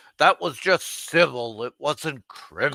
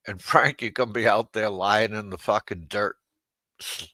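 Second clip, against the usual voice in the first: ordinary delivery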